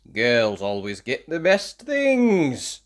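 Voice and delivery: put-on slow, sulky little boy's grumble; boy grumbling about his sister. A narrator's reading. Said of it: "grumbled Tim".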